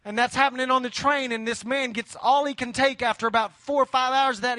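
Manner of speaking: ordinary talk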